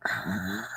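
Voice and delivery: very soft, low dog growl; imitated whisper growl. Imitating the dog's polite, barely audible growl. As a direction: growl under the breath, politely. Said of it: "she's doing it so quietly".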